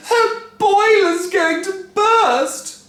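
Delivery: high, slow panicked wail. A narrator's reading. Wife panicking as Grandma steams, high wail. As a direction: wail in alarm. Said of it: "Missus Cranky wailed".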